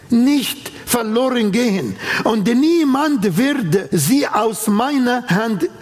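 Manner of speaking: ordinary talk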